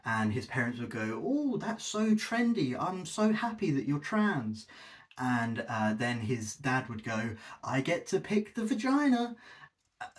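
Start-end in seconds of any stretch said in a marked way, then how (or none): 1.2-4.7 high, slow mock gushing parent. Parents cooing over their teen coming out, mock gushing. Parents voiced in [name]'s joke. 7.6-9.3 high mock leering dad voice. Dad voiced in [name]'s joke.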